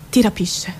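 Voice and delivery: ordinary talk, nothing marked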